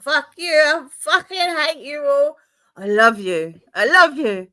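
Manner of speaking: put-on loud, high drunk flipping from hate to love; mock mood-swing drunk. Teasing her friend as a drunk, loud and hammed up. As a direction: ham up a drunk who hates then adores you. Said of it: "It's like".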